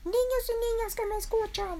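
tiny squeaky speech-maker; Berilio. Acted. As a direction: address the crowd in a very high chick voice. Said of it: "Y Berilio dijo".

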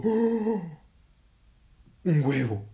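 high, loud gasp of wonder; amazed exclamation. Acted. Heron finds egg under her belly, amazed. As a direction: exclaim loudly, thrilled and amazed.

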